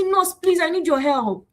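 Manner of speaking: high-pitched mock begging; pleading. Patient appealing to a nurse for help, pleading. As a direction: start the plea high, then ease down.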